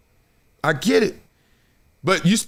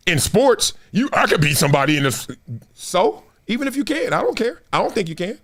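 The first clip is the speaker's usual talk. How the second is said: usual speaking voice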